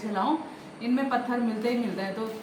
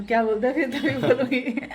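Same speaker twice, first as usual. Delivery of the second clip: laughing; 2 semitones higher than usual. Real, not acted.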